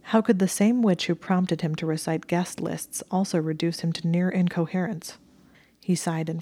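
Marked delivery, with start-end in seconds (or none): none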